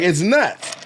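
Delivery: ordinary talk, nothing marked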